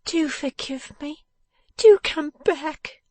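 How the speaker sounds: high, slow wife's plea; desperate high entreaty; earnest pleading. A narrator's reading. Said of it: "said his wife earnestly".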